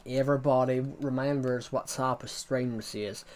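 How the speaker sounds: ordinary talk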